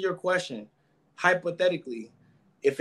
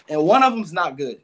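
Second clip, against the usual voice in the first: ordinary talk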